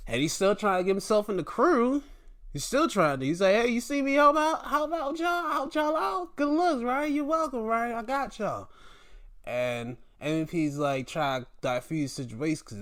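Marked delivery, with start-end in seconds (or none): 3.5-8.7 high-pitched eager pitch to join; high mock-helpful patter; eager pleading. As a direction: imitate him angling for approval, voice way up high. Acts wrestler wanting into crew.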